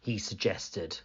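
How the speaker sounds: plain reading voice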